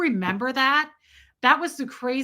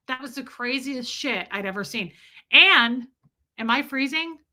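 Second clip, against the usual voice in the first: normal talking voice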